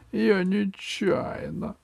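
high, slow whine; whimpering excuse. A narrator's reading. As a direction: whine it pitifully. M pleads.

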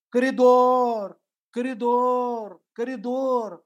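drawn-out shout, high and loud, slow; yelling. Retold or imitated. Man keeps yelling in the corridor, drawn-out shout. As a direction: bellow it out, dragging each word.

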